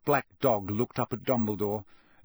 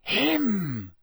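snarl; 8 semitones higher than usual. A narrator's reading. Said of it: "he snarled".

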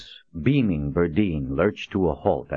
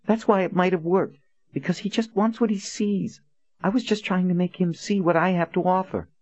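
put-on high, pleading young woman; a higher woman's voice, earnest; Nadine voice; 14 semitones higher than usual.

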